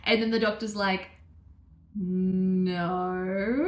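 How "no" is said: long held no, rising at the end; drawn-out correction. Retold or imitated. Doctor correcting the patient's guess, drawn-out and leading. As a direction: imitate a doctor gently contradicting, stretched out.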